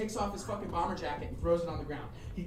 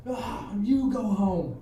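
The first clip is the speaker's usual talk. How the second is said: slow, louder wailed comeback; tearful retort; 3 semitones higher, 5 dB louder, much slower than usual. Retold or imitated. Beaten skinhead grabs his shirt, tearful mock retort. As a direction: imitate a blubbering last word, drawn out. Crying loser's last retort.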